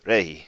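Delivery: rehi with a short breathy h; breathy h starts. Shown as a demonstration. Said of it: "Yes, it's a short, breathy H".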